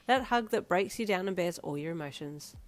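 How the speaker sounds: ordinary delivery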